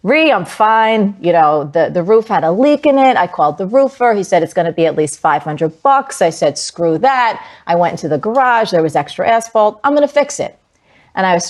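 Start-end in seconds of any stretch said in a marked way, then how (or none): 0.0-6.2 imitated Jersey mom, loud and brash; Jersey accent. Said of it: "in her very Jersey accent". 6.6-10.6 imitated feisty Jersey mom; raised-pitch Jersey mom impression; Jersey accent. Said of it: "in her very Jersey accent".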